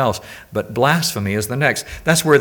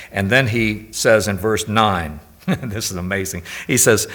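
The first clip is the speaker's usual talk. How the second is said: usual speaking voice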